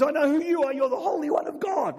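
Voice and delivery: a cornered demon crying out; high, strained demon's cry. Acted. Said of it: "and this demon says".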